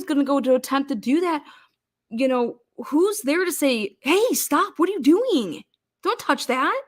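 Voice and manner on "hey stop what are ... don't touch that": high, swooping mock protest; alarmed objection. Bystander stops an investigator touching evidence, mock alarmed. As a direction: act out a bystander jumping in to object.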